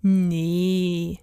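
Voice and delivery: long, easy drawn-out reply; relaxed. A narrator's reading. Rat calming eager Dieter, relaxed. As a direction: stretch it out, completely unbothered. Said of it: "entgegnete Rudi entspannt".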